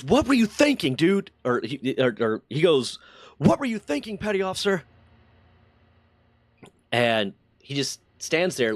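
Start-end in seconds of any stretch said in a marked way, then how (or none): 0.0-1.3 higher-pitched mock telling-off; imitated angry yell, partly held back; retold yelling. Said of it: "yelling at him". 3.4-4.8 high-pitched imitated reprimand; mock-angry dressing-down, not full volume; retold yelling. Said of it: "yelling at him".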